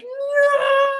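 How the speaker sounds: high, loud terrified "No!"; panicked child's scream. Acted. Scared kid shrieking at the scary gift box. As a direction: scream it like a four-year-old in a meltdown.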